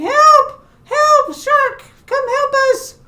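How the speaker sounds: high, loud storybook yells; screaming for help. Acted. Netted fish beg the shark for help, screaming. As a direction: shriek out a plea for rescue. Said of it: "yelling and screaming".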